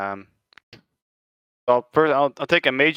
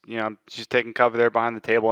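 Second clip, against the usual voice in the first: ordinary talk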